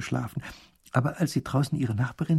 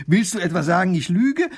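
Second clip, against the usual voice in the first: high, loud indignant challenge; wife offended by her doubting husband, high and loud; 6 semitones higher, 6 dB louder than usual. Acted. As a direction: snap back at him, offended. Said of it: "wird die Frau noch aufgeregter".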